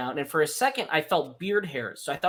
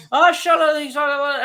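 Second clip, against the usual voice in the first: imitating a churchgoer's yell, much higher and louder; church screaming; 11 semitones higher, 8 dB louder than usual. Retelling the screaming people at his parents' church, high mock yell. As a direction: yell it like someone possessed at a revival. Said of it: "screaming and going".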